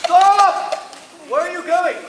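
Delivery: high, loud cry to stop; shouted halt. Acted. Passenger halting taxi driver who sets off, shouting. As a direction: call out after a departing cab.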